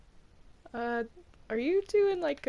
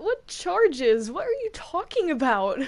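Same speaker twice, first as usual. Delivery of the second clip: normal talking voice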